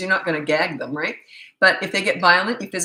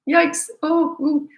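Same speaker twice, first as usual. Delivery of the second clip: sudden high yelp of alarm; startled yikes; 10 semitones higher, 4 dB louder, much slower than usual. Real, not acted. Overwhelmed by the idea of nothing, yelping yikes. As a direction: recoil from a scary thought.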